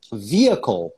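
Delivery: "vehicle" as VEE-uh-kol, silent h; correct vehicle. Shown as a demonstration. Teacher giving the example word, correct "vehicle". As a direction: say "vehicle" with first-syllable stress, VEE-uh-kol. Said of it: "eu não falava vehicle".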